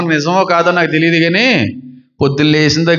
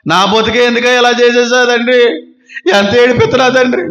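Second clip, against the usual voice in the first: mock whiny prayer; 5 semitones higher than usual. Mocking a self-pitying prayer.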